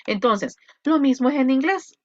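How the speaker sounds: ordinary talk, nothing marked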